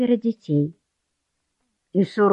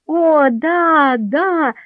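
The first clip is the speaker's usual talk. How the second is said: high, excited children's cheer, mildly voiced; gleeful yelling; 8 semitones higher, much slower than usual. A narrator's reading. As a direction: cry out like delighted kids. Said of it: "завопили Люк и Хелен".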